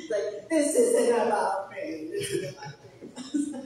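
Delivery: laughing. Real, not acted. Laughing correction of himself.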